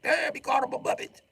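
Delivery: high put-on chat reaction; squeaky chat reaction; excited chat voice. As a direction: voice the chat going wild. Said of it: "the chat go crazy".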